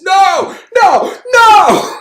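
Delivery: very high, loud mock wail; hammed-up cry of total refusal; mimicking The Office. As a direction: scream "no" in exaggerated horror. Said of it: "my initial chance was The Office".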